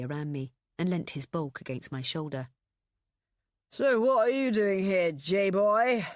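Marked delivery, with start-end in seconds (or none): 3.7-6.1 put-on loud, higher drunken challenge; getting louder. A narrator's reading. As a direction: push the volume up like a drunk picking a fight. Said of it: "his voice getting louder".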